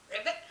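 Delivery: croaked frog call with a rise; frog ribbit. Shown as a demonstration. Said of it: "Here we go".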